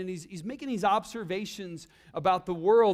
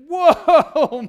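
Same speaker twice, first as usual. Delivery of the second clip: a loud, amazed whoa as Adam; Adam seeing Eve for the first time, blown away; big excited gasp of wonder; 5 semitones higher, 13 dB louder than usual. Retold or imitated. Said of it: "He is excited".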